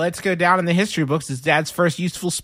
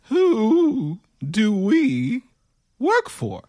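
very slow "Who... do we... work for?", pitched up; drawn-out question; 6 semitones higher, much slower than usual. Acted. Player quizzes the robot, stretching "Who do we work for" very slowly.

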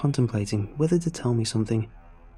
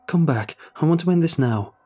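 raised, urgent demand; higher, desperate call; shouted; 5 semitones higher, 5 dB louder than usual. A narrator's reading. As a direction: shout for her return. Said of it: "I shouted".

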